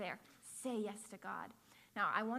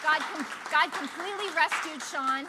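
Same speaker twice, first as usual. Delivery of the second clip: preached proclamation; 6 semitones higher, 11 dB louder than usual. Real, not acted. Preacher repeating the line to the congregation, louder. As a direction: proclaim it so the whole church hears.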